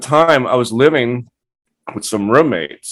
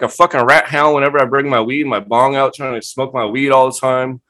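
ordinary delivery

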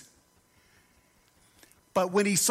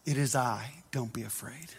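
hushed Jesus voice; 8 semitones lower, 6 dB quieter than usual. Acted. Preacher voicing Jesus to frightened disciples, low and hushed. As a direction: drop low and soft, almost a murmur.

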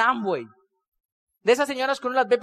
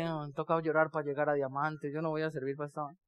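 muttered aside; 9 semitones lower, 11 dB quieter than usual. Retold or imitated. His own inner reaction, muttered.

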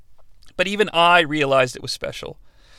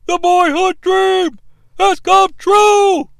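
high, slow, drawn-out exclamation; hammed-up ringside call at a title win; mock commentator cry; 11 semitones higher, slower than usual. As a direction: wail it triumphantly, stretching each word. Imitating famous wrestling commentary call.

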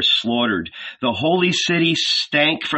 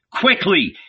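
pressing them on, higher and urgent; urgent exhortation; 6 semitones higher than usual. Acted. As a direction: hurry them along. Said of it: "he exhorted his companions".

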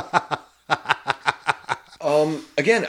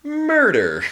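dramatic high reveal. Acted.

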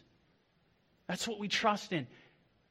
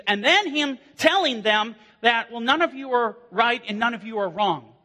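rising, louder sermon build; fiery preaching; 5 semitones higher, 5 dB louder than usual. Acted. Pastor warning church about false shepherds, fiery preaching. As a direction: preach it hard to the pews. Preacher decries seminary professors.

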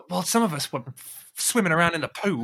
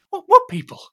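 sudden high, loud what; jolted into a high yelp; shocked squeal; 12 dB louder than usual. Acted. Friend shocked to hear the glue is people, yelping high.

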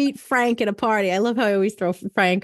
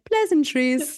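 mock posh; 5 semitones higher, slower than usual. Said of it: "you'll be like".

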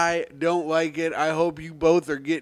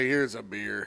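put-on drunk guy voice; 7 semitones lower, 7 dB quieter than usual. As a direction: lower and softer, a lightly voiced mellow drunk. Voicing the laid-back drunk guy.

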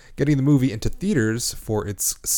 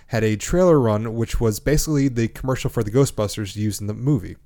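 ordinary delivery

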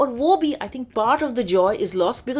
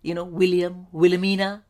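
ordinary talk, nothing marked

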